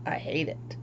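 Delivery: hushed, fed-up whisper; whispered frustration. Real, not acted. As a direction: whisper it, worn down. Repeating complaint.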